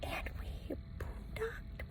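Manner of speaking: slow, soft whisper. Real, not acted. Telling Benji where they camped, whispered. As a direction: hush it like a secret.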